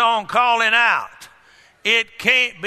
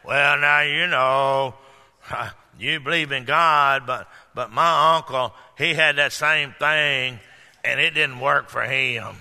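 low, sing-song mock naysayer; doubting naysayer; 7 semitones lower than usual. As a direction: drone it like a know-it-all skeptic. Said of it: "somebody else comes up and says".

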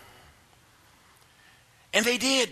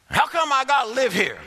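mock grumbling; 6 semitones higher than usual.

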